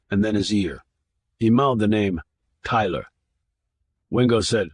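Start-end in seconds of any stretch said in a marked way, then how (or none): none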